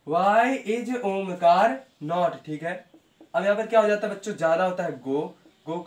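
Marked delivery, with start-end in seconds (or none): 0.1-2.5 each word drawn out; very slow, deliberate read-out; slow dictation. Shown as a demonstration. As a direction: dictate it slowly to the class. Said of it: "teacher dictating".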